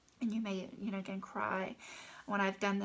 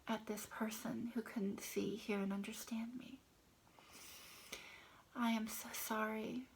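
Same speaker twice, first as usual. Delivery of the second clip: soft, half-whispered self-acceptance script; hushed, intimate affirmation read; tapping affirmation; 4 dB quieter than usual. Shown as a demonstration. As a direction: murmur it gently to yourself while tapping.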